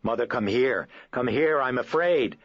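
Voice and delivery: put-on high, loud, panicked child's cry; terrified scream. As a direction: scream for your mother in terror. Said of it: "fearful, hysterical screaming".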